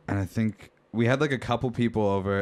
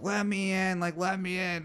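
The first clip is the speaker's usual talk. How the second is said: put-on high, whiny drunk girl voice, much higher; drunk girl pleading; 11 semitones higher than usual. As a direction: beg to be let in like a hammered girl.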